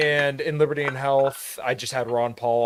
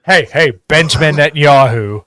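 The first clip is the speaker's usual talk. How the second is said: mock shout; 12 dB louder than usual.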